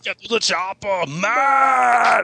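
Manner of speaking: high, loud action-hero shout; Schwarzenegger impression. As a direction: yell it like Arnold.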